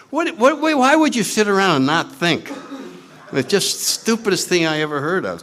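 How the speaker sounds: high, sputtering disbelief; incredulous scoffing. Retold or imitated. Newcomer rejecting meditation to his questioner, incredulous. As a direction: act out being baffled by a dumb idea.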